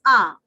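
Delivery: "a" as the open ah in are; open "a" sound. Shown as a demonstration. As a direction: say "a" like the vowel of are. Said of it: "It is giving the sound of a".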